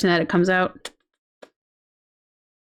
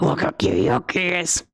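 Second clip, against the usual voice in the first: low growly monster take; 6 semitones lower than usual. Shown as a demonstration. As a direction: say it like a cute monster. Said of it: "the monster voice".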